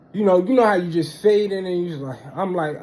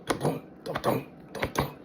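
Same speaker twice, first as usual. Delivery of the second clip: soft hushed heartbeat thumps, much quieter; imitated heartbeat; 12 dB quieter than usual. Telling friends about his racing heart, hushed heartbeat thumps. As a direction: breathe out the pounding heart, barely voiced. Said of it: "my heart start doing".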